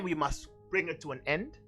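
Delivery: ordinary talk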